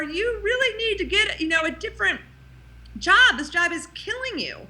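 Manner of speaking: a high insistent inner voice telling the truth; inner wisdom voice. Retold or imitated. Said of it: "inner wisdom who's saying".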